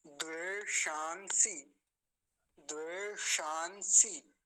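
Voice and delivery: द्वेषांसी drawn out very slowly, retroflex sh then dental s; slow model reading. Shown as a demonstration. Teacher modelling how the word must be said. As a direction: say द्वेषांसी very slowly, sounds apart. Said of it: "ऐसा इसका उच्चारण होगा".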